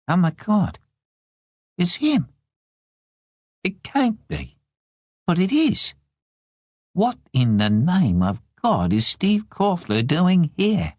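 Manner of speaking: can't believe who has just turned up; stunned disbelief. A narrator's reading. Sees unwelcome visitor arrive, in disbelief.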